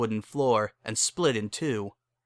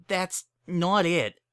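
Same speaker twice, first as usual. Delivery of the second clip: high, slow, pleading pony voice; slow it down, dreading what comes; Bon Bon, hesitant; 7 semitones higher, slower than usual. Acted. Bon Bon stalling before bad news, slow and high.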